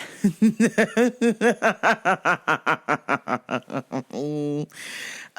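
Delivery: awkward nervous laughter; uncomfortable laugh. Real, not acted. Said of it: "that's my uncomfortable laugh".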